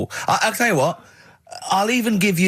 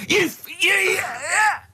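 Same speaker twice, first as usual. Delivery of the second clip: high, cracking laughter that cries out; laughing so hard it breaks the words; shrieking with laughter; helpless laughter; 14 semitones higher, much slower than usual. Real, not acted. Host breaks down laughing at punchline.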